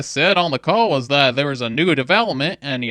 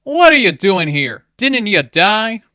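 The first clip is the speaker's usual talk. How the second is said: angry; 5 semitones higher, 8 dB louder than usual. Acted. Jabali confronts the spirit cat.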